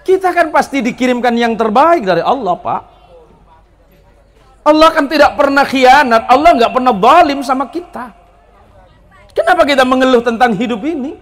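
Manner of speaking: loud, rising sermon delivery; preacher exhorting the congregation to stop complaining; full-force preaching. Acted. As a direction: drive each question home to the crowd. Preaching to the crowd.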